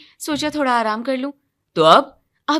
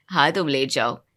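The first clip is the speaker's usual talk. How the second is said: normal talking voice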